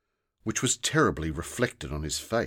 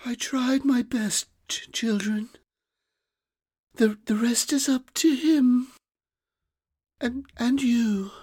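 much higher, faint, faltering voice of an exhausted fairy; weary fairy; 4 dB louder than usual. Acted. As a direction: stumble through it, drained and small. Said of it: "spoke in a weary voice".

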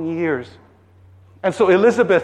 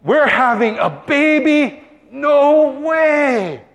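high-pitched, drawn-out amazement; overjoyed disbelief; 7 semitones higher, slower than usual. Acted. Elizabeth realises the baby news, high and amazed. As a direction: let it soar in astonishment.

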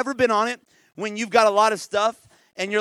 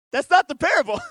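exclaiming; 5 semitones higher, 7 dB louder than usual. Real, not acted. Laughing correction to the crowd.

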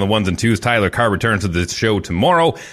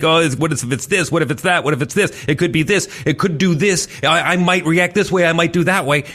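mock worry; 7 semitones higher than usual. Imitating anxious kid's thoughts.

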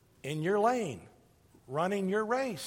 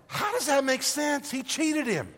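higher, baffled protest; you can't believe the master praised a cheat; puzzled, outraged question; indignant disbelief; 6 semitones higher than usual. Acted. Listeners' indignant reaction, acted.

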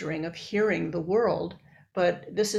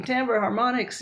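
normal talking voice

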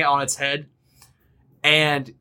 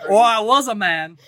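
boisterous captain; 6 semitones higher than usual. Acted. GM greeting the new crew as a brash high-voiced captain. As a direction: bellow a welcome as a brash captain. Captain replies about age.

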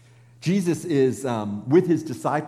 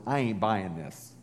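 skeptical mutter; 7 semitones lower, 6 dB quieter than usual. Retold or imitated. Peter's doubt paraphrased.

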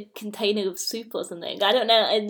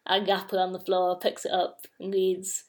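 ordinary talk, nothing marked

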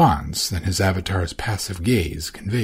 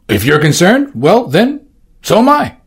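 climbing high, louder sympathetic voice; voiced Orsino; 10 semitones higher, 8 dB louder than usual. Orsino reassuring Valentine, rising higher. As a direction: rise higher with each phrase.

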